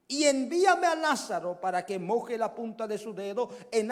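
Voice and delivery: loud, high pleading cry. Acted. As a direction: beg for water at full voice. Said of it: "él dando voces dijo".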